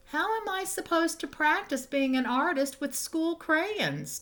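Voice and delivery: put-on high, childlike complaining voice; Tommy's indignant question. Tommy complains about school crayons.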